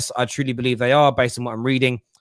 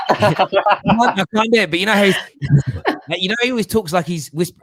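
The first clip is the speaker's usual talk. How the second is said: ordinary talk, nothing marked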